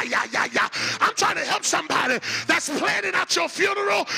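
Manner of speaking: loud preacher working up to a shout; fired-up, defiant sermon delivery; full-force preaching. Acted.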